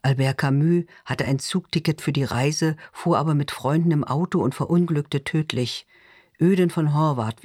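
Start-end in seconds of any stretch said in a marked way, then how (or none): none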